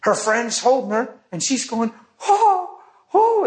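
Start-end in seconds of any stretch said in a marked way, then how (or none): 2.3-3.4 high, mock-overwhelmed "oh"s; dramatic "oh". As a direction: imitate her swooning in high-pitched ohs. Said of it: "she's getting pretty dramatic".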